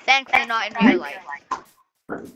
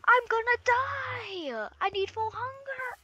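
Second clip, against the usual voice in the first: high whiny voice. Acted. Starving, begging for food.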